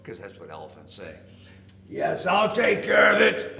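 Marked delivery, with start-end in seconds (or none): 1.9-3.6 loud, high, confident elephant. A narrator's reading. Elephant promising the rabbit help, loud confident voice. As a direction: declare it big and loud. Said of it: "elephant said".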